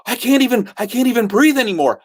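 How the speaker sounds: lightly acted desperation; retold panic. Drowning man panicking, retold.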